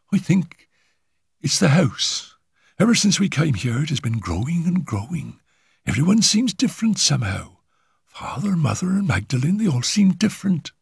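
lightly voiced scared girl confiding; a timid girl unsettled by the house; frightened young girl. A narrator's reading. Girl character voice.

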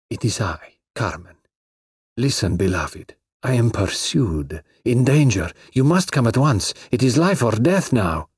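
put-on foreign-accented urgent plea; hushed then urgent foreign woman; Carmen pleading. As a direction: beg him to come, life or death.

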